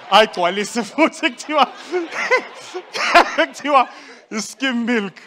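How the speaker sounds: loud, high punchline through laughter; laughing shout. Real, not acted. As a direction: shout it while cracking up.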